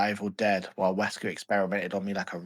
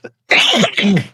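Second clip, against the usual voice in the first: loud sudden cough; a harsh cough breaking in; real cough; 10 semitones higher, 13 dB louder than usual. Real, not acted. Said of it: "That was me coughing, not Wesker".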